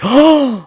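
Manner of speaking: high, theatrical gasp of fake shock; mock gasp. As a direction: gasp as if scandalized.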